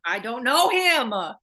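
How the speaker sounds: loud, high, slow laughing wail; laughing protest. Real, not acted. Laughing at teasing.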